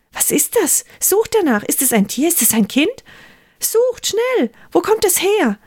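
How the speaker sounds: high, urgent commands; rising alarm, send them searching fast; urgent princess. Acted. Princess orders servants to find the crying, urgently high.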